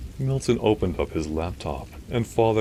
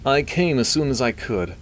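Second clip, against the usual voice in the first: high, louder nasally academic voice; nasally would-be British; 8 semitones higher, 5 dB louder than usual. A narrator's reading. Doctor arriving at the church to his friend, nasally. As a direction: talk through the nose, straining to sound British. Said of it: "He had a nasally voice".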